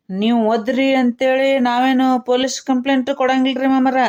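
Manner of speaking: usual speaking voice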